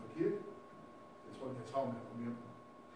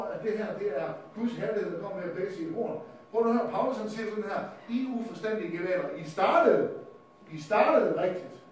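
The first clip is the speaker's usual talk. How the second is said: loud, higher-pitched pulpit indignation; forceful preaching rebuke; 6 semitones higher, 10 dB louder than usual. Real, not acted. Preacher scolds the shofar blowers with Paul, forceful preaching. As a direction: hammer it at the congregation.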